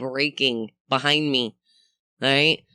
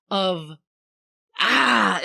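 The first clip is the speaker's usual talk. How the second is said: ordinary talk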